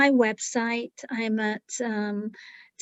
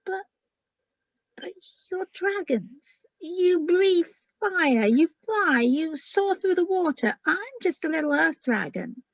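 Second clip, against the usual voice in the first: a hint of a little dragon, unsure of herself; small-dragon voice; 5 semitones higher than usual. Little earth dragon protesting to the big dragons, lightly higher and timid.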